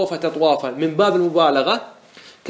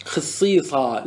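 slow demonstration; much slower than usual. Shown as a demonstration. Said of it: "سمعنا العرب تقول".

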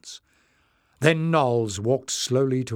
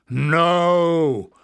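loud, higher, drawn-out refusal; angry shout; 6 semitones higher, 7 dB louder than usual. A narrator's reading. Leader refusing the crowd's plea, loud and angry. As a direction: burst out in anger. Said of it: "face was twisted with anger".

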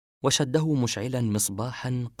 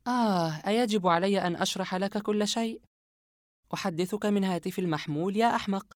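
a hint of bored annoyance; sighing, fed-up explaining; weary impatience; 10 semitones higher than usual. Yumna tired of explaining to Asem, mildly impatient. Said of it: "يمنى بتململ".

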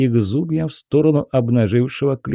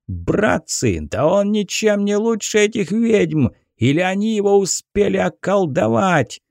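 high, furious rant to the crowd; angry shouting; 8 semitones higher than usual. Acted. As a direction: whip up the mob, shouting. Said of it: "заорал мужик". (яростный крик)

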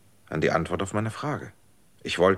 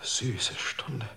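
hushed awe; 4 dB quieter than usual. Acted. Savouring the hour he won.

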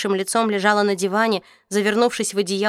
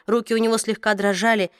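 ordinary delivery, nothing marked

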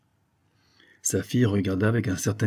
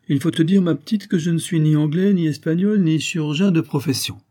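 higher, louder, assertive reveal; unmasked adventurer; 7 semitones higher, 5 dB louder than usual. A narrator's reading. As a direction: reveal yourself boldly, talking down to her. Rocambole drops disguise, addresses Saphir. (aventurier qui se dévoile)

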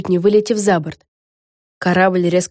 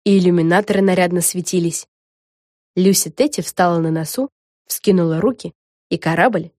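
plain reading voice

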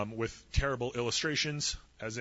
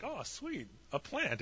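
pleased Jonah; 5 semitones higher, 7 dB quieter, slower than usual. Retold or imitated. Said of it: "Jonah's like".

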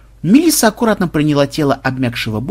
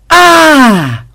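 high, loud "Aah!"; panicked scream; 13 semitones higher, 10 dB louder than usual. Acted. Programmer rushing at the guards in mock panic, loud scream. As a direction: scream out in staged alarm.

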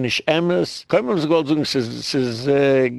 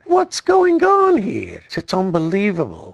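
outraged outcry; 5 semitones higher than usual. Real, not acted. Speaker reacting to what the video shows, outraged outcry.

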